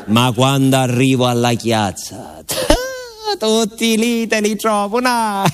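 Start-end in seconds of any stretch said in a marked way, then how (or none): 2.5-3.3 a squeaky high burst of laughter; high giggle. Acted. Laughing at crowd in square.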